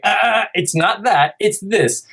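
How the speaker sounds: put-on high, slow correcting of a child; mock a fussy correcting parent; a hint of a scolding parent. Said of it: "you're not necessarily supposed to be like".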